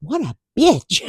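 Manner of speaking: lightly voiced grumbling man; slow mock judgment; a hint of an offended man; mock gentleman. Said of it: "Gentlemen ... you're going".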